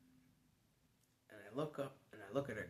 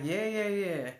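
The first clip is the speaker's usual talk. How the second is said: insistent; 8 semitones higher, 7 dB louder than usual. Retold or imitated. Insisting to girlfriend.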